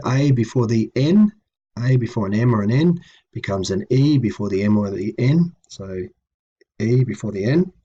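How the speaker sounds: ordinary delivery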